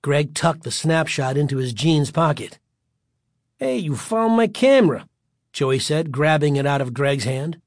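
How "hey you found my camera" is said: raised, mocking bully voice; bullying taunt. A narrator's reading. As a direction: crow it like a bully claiming a prize. Bully grabs the camera.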